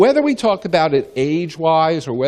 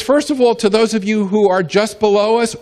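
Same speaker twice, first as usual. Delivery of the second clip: ordinary talk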